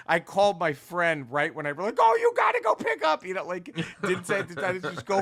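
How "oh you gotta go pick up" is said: squealed much higher and louder, rushed fast; breathless, hyped squeal of a recommendation; excited urging. Retold or imitated. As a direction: gush at your friend to go get it now. Urging friend to buy comic.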